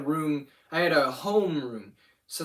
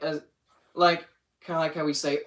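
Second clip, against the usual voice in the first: ordinary delivery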